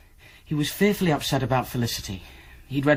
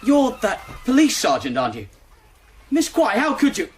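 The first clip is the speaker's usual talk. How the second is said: higher, hurt and accusing; indignant outburst; 10 semitones higher than usual. Acted. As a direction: lash out at the betrayal. Accusing her of betraying him.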